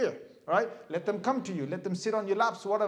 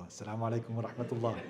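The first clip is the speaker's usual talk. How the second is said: low, quick gabbled salam; tossed off in a hurry to get to the phone; rushed salam; 9 semitones lower, 6 dB quieter, much faster than usual. Retold or imitated. Imitating a brother reaching for his phone, rushed mumble. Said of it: "and then you grab your phone and go".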